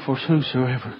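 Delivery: low, soft mumbled reading; imitated mumble. Preacher imitating boy who cannot read, low soft mumble. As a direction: mumble it low, as if barely able. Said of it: "He mumbled".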